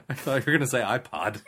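ordinary talk

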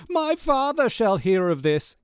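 high, loud, slow mock outrage; hammed-up indignant brat threat; spoiled rich kid. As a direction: proclaim it like a pampered heir. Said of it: "Jack feels like one of those".

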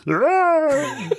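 high, zany cartoon squeal; cartoon crazy voice. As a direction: play it like a loony cartoon. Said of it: "doing a cartoon gesture of like".

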